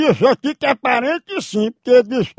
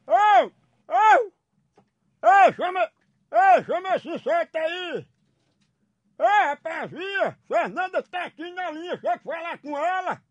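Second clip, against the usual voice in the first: calling out far off; 6 semitones higher, 7 dB quieter than usual. Acted. Calling the man from afar.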